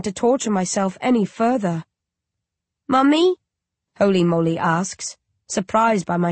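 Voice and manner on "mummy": put-on high, innocent little child asking; a small uncanny baby calling its mother. Undead baby asks its grieving mother, high and childlike. Child character Holy Moly.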